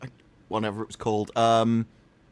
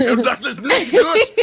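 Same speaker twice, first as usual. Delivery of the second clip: loud, high mock-aggressive German; 11 semitones higher, 6 dB louder than usual. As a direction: bellow it like a comically angry German. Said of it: "has to be aggressive".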